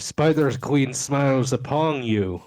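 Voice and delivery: half-hearted passcode. Acted. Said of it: "I'm gonna say the passcode".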